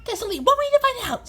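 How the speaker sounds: high, quick excited character voice. A narrator's reading. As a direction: squeak it out eagerly and fast.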